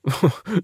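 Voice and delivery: short high chuckle; a friendly old man laughing; old man's laugh. A narrator's reading. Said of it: "Der Mann lachte".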